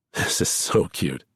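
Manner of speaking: slow, laughing through the words; drawling out so through giggles; amused. A narrator's reading. Charlie teasing Lamb, slow and laughing. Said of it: "bent double ... with laughter".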